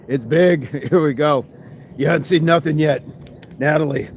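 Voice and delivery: ordinary delivery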